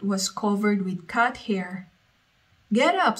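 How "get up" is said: high, loud wake-up shout. Acted. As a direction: yell it to rouse the sleepy cat. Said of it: "Sophia shouted".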